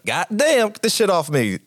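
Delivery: high, sharp curse while flailing; disgusted outburst. Acted. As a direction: squeal it out in disgust. Character shaken, covered in flesh.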